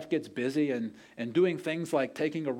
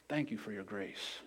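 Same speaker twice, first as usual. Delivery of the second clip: tender thanks; 6 semitones lower, 11 dB quieter than usual. Retold or imitated. Wife thanks husband tenderly.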